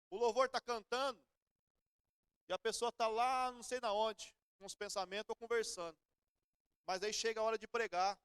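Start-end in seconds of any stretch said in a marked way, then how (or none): none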